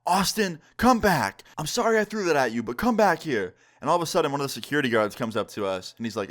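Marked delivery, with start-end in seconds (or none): 0.1-3.5 high-pitched mock yell, held back; retold scream, raised but not full volume; imitated screaming. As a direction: call after someone walking away, pitched up. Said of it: "she starts screaming at me".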